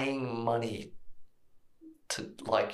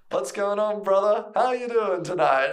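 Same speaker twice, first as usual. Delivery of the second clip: higher, louder, overly friendly greeting; retelling an overfriendly bathroom attendant greeting a stranger, higher and louder; mock attendant voice; 8 semitones higher, 6 dB louder than usual. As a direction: play the pushy bathroom attendant, all chummy.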